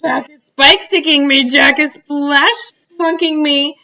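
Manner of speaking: high-pitched, frantic giant; panicked bellowing. A narrator's reading. Giant bellows about Jack attacking him, panicked. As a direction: wail each new torment in terror. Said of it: "bellowed the Flesh Lump Eater".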